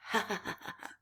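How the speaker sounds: breathy villainous chuckle; a sly hammed-up ha ha ha; soft sneaky baddie laugh; thieves chuckling. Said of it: "The two thieves chuckled".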